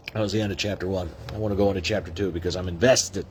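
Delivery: usual speaking voice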